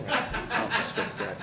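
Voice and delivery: slow, laughing through the words. Real, not acted. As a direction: chuckle while fixing the glass. Laughing after a glass mix-up.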